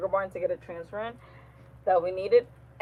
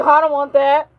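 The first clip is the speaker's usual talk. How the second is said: loud, raised-pitch refusal; mock protest; 6 semitones higher, 11 dB louder than usual. Coworker refusing a teased girlfriend match, protesting loudly. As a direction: imitate an indignant, loud refusal.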